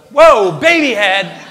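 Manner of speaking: loud, startled exclamation; startled shock. Retold or imitated. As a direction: blurt it out in comic shock. Retold shock as head pops out.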